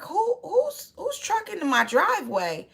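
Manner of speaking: sudden high-pitched alarmed question; startled who's-that-truck outburst; shocked demand. Retold or imitated. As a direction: jump up in pitch, caught off guard by the truck. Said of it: "and she's like".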